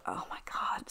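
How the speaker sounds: breathy retold gasp of disbelief; hushed shocked aside; stunned whisper. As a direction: whisper it like the news just hit you. Caller stunned by warrant news.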